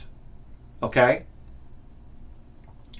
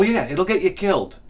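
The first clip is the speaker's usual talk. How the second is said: usual speaking voice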